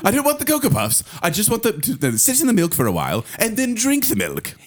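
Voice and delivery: put-on creepy child ordering his mother about; creepy posh child. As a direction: demand it like a creepy spoiled boy. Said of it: "Why is [name] turning into Stewie".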